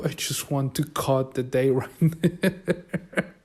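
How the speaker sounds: breaking into a chuckle while imitating her; slow down and let a laugh break in; retold laughing confession. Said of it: "she starts laughing and saying".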